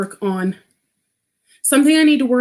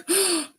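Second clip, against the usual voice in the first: quick soft high gasp of recognition; a quiet sudden gasp; sharp gasp; 9 semitones higher, 7 dB quieter than usual. Retold or imitated. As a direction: gasp like you've just found a soulmate. Reacting to mom's confession.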